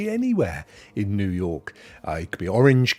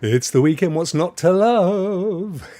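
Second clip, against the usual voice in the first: louder, rising into a drawn-out finish; exuberant cheer; 6 semitones higher, 5 dB louder, slower than usual. Real, not acted. Streamer cheering viewers into the weekend, exuberant. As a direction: celebrate it, stretching the end. Livens up a viewer's chat message.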